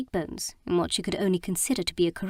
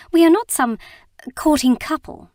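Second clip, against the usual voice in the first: flustered; 8 semitones higher, 8 dB louder than usual. Acted. Flustered outburst at Nye.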